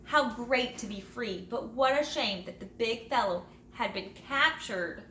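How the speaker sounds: plain reading voice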